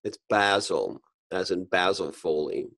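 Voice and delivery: basil said BAZ-il, softly; Basil like the man's name, short A. Shown as a demonstration. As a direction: say "basil" to rhyme with "dazzle". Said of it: "Is it basil or basil?".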